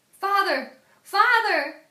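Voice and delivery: high desperate shouts; children crying out to their father; calling out. Acted. Children led away, shouting for their father.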